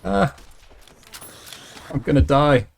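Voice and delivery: panicked high yelp; loud frightened cry as ghouls close in; being chased. Real, not acted.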